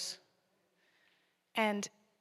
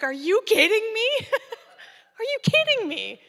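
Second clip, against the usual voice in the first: high, loud, laughing outburst; laughing disbelief; 13 semitones higher, 10 dB louder than usual. Retold or imitated. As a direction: burst out laughing at bad news. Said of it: "I just bust out laughing".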